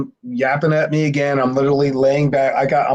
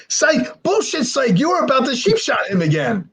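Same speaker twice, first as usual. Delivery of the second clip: high-pitched, jeering disbelief; crowd's incredulous outcry; 11 semitones higher than usual. Retold or imitated. People accusing Chad of another sheep shot, incredulous outcry. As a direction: call out his bluff like heckling onlookers. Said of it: "people are like".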